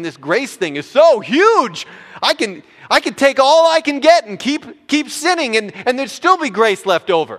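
high, loud, gleeful overstatement; mock exuberance. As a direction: exclaim like someone who found a limitless loophole. Voicing a greedy believer's reasoning.

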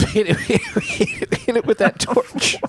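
slow, laughing through words. Real, not acted. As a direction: laugh while saying it. Laughing slowly.

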